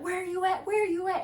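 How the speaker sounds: high, insistent, repetitive nagging call; mock yelling friend. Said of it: "she just yelling".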